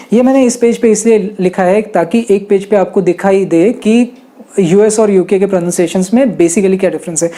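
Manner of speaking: normal talking voice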